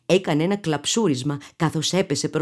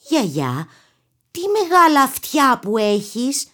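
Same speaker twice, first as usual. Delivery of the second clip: put-on girl voice; 6 semitones higher than usual. A narrator's reading.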